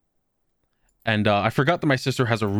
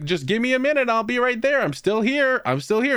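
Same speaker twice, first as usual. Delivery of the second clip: much higher, reassuring call upstairs; calling to the dog; 12 semitones higher than usual. Retold or imitated. Owner calls down to the anxious dog, high reassuring voice. I'll talk to him from upstairs.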